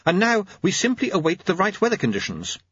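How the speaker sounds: high-pitched earnest clockmaker; high, keen clockmaker voice; Jeremy. Acted. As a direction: pitch it up for an eager young inventor.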